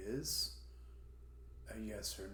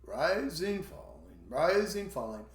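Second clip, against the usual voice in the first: "rising" high and loud, "falling" dropped; teacher's up-down demonstration on "rising, falling"; rising-falling intonation; 5 semitones higher, 5 dB louder than usual. Shown as a demonstration. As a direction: lift "rising", let "falling" sink. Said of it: "it's going to be".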